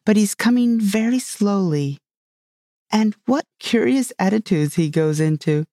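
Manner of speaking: Alice's understated wonder at an odd sight; exclaiming. A narrator's reading. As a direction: remark on it with light amazement. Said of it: "she exclaimed at last".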